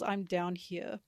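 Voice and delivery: usual speaking voice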